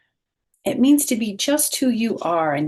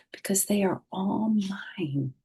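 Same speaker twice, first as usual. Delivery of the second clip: whispered; 4 semitones higher, 4 dB quieter than usual. A narrator's reading. Hushed reading of the book.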